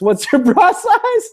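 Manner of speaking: high, slow, laughing read-out of a silly question; mock incredulous read. Streamer reading a crude viewer question to chat, high mock disbelief. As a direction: squeal it out in disbelief, giggling.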